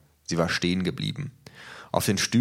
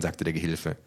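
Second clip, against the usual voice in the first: plain reading voice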